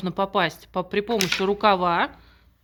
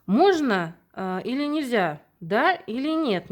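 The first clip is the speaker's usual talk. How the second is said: slow, higher sing-song question; slow child-directed question; 4 semitones higher, slower than usual. Shown as a demonstration. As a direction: draw it out for a small child. Teacher quizzes a young child.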